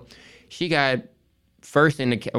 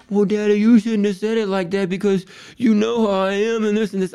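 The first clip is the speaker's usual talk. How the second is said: higher imitation of a hurt young daughter; imitating his daughter; 10 semitones higher than usual. Dad retelling daughter scolding him, higher mock child voice. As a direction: pitch up to voice the child pushing back.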